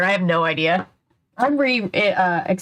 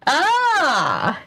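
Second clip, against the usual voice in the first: sudden high startled cry; startled yelp; 8 semitones higher than usual. Real, not acted. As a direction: jump and yelp in fright. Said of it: "You scared me".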